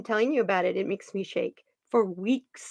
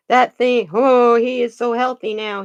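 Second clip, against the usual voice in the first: delighted; 2 semitones higher, 8 dB louder than usual. Real, not acted. Delighted about the cougar.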